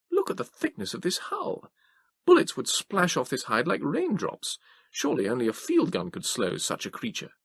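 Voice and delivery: put-on high, marvelling old gentleman; Moses impressed. A narrator's reading. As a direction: admire the hull, wide-eyed. Moses voiced.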